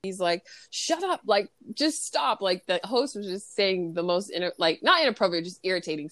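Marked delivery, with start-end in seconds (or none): 0.7-2.4 higher-pitched mock exasperation; lightly acted frustration; super frustrated. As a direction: imitate a fed-up cook cutting someone off. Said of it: "he's super frustrated".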